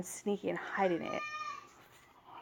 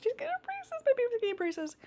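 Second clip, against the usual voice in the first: high, cooing excitement; 4 dB louder than usual. Real, not acted. Parent on child getting braces tomorrow, high gushing.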